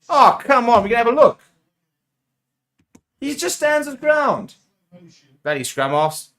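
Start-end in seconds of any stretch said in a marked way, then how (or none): 0.1-1.4 loud, high protest; real exasperated shout; exasperated outcry. Real, not acted. Protests the officials' decision. 3.2-4.6 high, slow, drawn-out protest; incredulous wail in a player's defence; indignant outcry. Real, not acted.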